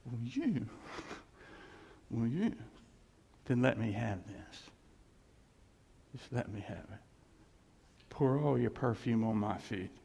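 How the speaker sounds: low, soft, halting appeal; gentle divine request. Retold or imitated. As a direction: ask tenderly, leaving long pauses. Voices Jesus's gentle request.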